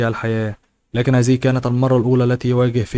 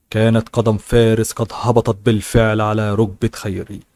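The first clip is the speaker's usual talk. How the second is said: ordinary delivery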